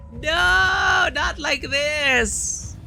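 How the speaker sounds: high, loud cry of disbelief; horrified shout. Real, not acted. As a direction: yell it in sheer horror. Worm face bursts from her neck.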